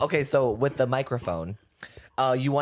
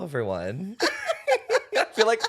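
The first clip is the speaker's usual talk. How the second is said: laughing; 6 semitones higher than usual. Real, not acted. Nervous laugh at start.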